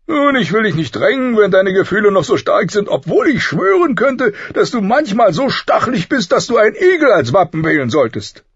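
high, booming, grudging concession; blustering teasing king. A narrator's reading. King easing off but teasing his prickly friend, blustering. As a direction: back off loudly, with a jibe.

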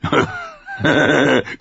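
big rough belly laugh; wildling chuckle; hearty laugh. A narrator's reading. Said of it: "grinste der Wildling".

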